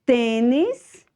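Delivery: Tênis said high, closed tê; model word. Shown as a demonstration. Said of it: "Acento circunflexo no T".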